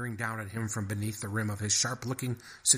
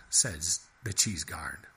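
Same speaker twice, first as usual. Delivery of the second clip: plain reading voice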